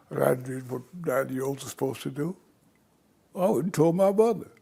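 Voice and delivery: normal talking voice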